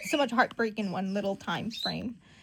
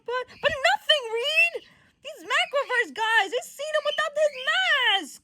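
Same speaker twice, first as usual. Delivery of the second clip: loud, high, heated rebuttal; insistent argument; 5 dB louder than usual. Acted. Johnny cutting Reed off about the mask, heated insistence. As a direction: argue over them in a raised voice.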